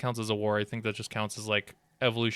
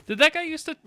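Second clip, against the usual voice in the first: sudden loud jump much higher; accidental shrill spike that clips the mic; startled peak; 5 dB louder, faster than usual. Real, not acted. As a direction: blurt it way too loud into the mic. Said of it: "oh, wow. I just peaked my microphone".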